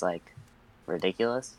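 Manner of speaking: ordinary talk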